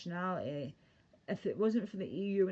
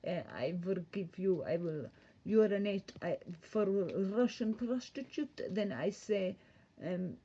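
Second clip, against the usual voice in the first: hammed-up seductive Russian accent; sexy Russian-accented voice. Streamer plays Russian prostitute naming prices, mock-sexy Russian accent. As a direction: play a halting Eastern European seductress with a Russian accent. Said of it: "It's very sexy voice".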